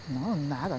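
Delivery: usual speaking voice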